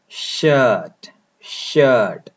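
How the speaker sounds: शर्ट said slowly with a long vowel and no r sound; model pronunciation. Shown as a demonstration. Teacher modelling the word for students, slow and careful. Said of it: "pronounce करें".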